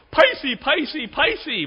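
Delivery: put-on high toddler wail of pisey, pisey; crying toddler. Pastor imitates toddler son crying after vinegar, high wail. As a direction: mimic a small child's pained cry. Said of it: "which was spicy".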